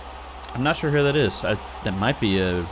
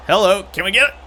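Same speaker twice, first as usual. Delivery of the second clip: sudden lift, higher and louder; surprised hope; 8 semitones higher, 4 dB louder, faster than usual. Real, not acted. Gamer spotting an open goal chance, surprised.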